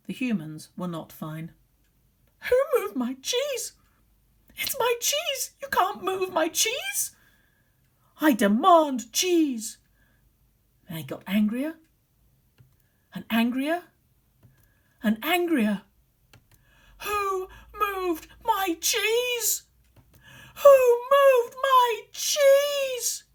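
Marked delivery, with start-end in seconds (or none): 2.5-9.8 high, loud, slow tantrum of outrage; indignant demands. Acted. Teacher acting the humans throwing a tantrum for the children, high and loud. Humans rage over the moved cheese. 10.9-15.8 building anger. A narrator's reading. 17.0-23.2 high, very slow, loud mock crying wail; hammed-up wailing. Teacher hamming up the humans crying over cheese, slow high wail.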